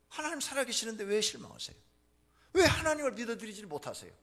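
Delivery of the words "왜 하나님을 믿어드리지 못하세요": ordinary delivery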